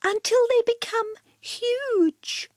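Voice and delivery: prissy cat explaining; Cat's voice. A narrator's reading. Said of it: "she said".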